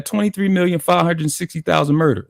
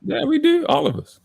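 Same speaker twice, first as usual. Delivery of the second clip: high-pitched agreement; 13 semitones higher than usual. Real, not acted. Playfully owning up to the accent.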